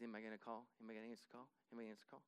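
low, hushed mutter to himself; acted-out private waiting, soft and low; nervous muttering. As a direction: murmur it anxiously under the breath. Acting a man anxiously waiting to himself.

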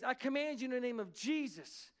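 commanding prayer. Retold or imitated. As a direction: retell a minister commanding in prayer. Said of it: "And he prays".

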